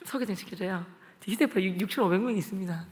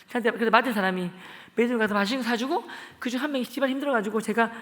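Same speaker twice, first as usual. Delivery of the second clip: ordinary talk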